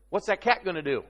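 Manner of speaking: usual speaking voice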